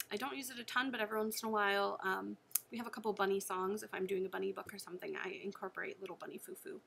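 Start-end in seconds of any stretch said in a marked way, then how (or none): none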